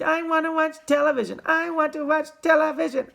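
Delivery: high, loud squeaky demand; shrill miniature boy's tantrum; squeaked tantrum. A narrator's reading. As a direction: keep squealing like a tiny furious child. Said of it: "he squeaked".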